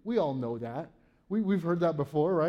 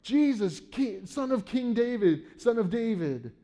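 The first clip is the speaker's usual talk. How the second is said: crying out; 6 semitones higher than usual. Retold or imitated. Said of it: "they cry out".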